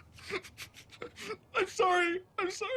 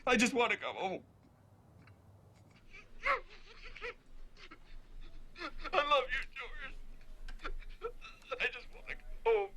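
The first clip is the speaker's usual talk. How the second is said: weeping, words in broken bursts; crying; 4 dB quieter than usual. Acted. Homesick, begging George's forgiveness, crying. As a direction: sob between the phrases. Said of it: "I'm sorry you're crying".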